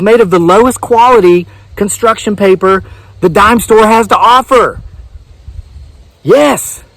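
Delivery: high, eager TV pitchman; infomercial voice. Acted. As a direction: hype it like a shopping channel host. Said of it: "my infomercial voice".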